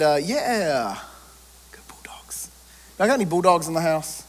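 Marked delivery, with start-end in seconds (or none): 1.7-2.5 breathy half-voiced whisper; whispered aside. Real, not acted. Coach-pastor whispering a team cheer to himself, breathy whisper. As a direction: slip it out under the breath.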